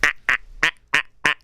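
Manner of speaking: short, evenly spaced "ah" bursts; mock his mechanical laugh, beat by beat; mimicked staccato laugh. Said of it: "That's how he sounds".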